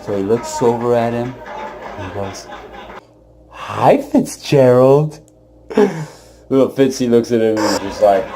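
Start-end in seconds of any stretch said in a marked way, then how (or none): 3.5-5.2 put-on old man greeting.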